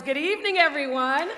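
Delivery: high, excited crowd-rousing call; rousing greeting. Real, not acted. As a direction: call it out high to get a cheer back. Repeats greeting to rouse quiet audience.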